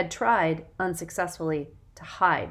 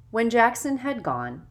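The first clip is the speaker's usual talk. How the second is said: ordinary delivery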